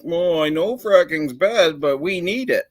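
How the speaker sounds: lightly mock the politicians. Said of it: "they're like".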